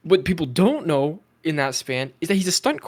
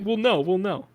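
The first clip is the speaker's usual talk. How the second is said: high-pitched mock assurance; James Gunn; 8 semitones higher than usual. Host mocking the studio head's vague updates, high mock voice. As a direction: parrot a vague promise in a higher voice. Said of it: "James Gunn is like".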